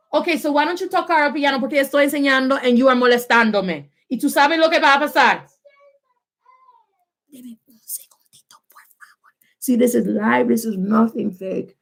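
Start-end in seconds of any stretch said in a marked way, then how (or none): 0.1-5.5 exasperated parent. Real, not acted. Mother warning child who bothers her while teaching, exasperated. Warns child interrupting class. 7.3-9.3 barely audible whisper; whispered request. Real, not acted. Teacher asking class for a moment, whispering. Aside to class while handling child.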